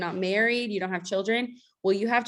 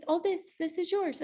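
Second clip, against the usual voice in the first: much higher, light voiced host; host's question; 10 semitones higher, 5 dB quieter than usual. The host showing her the house, voiced much higher. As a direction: voice the host, bright and high.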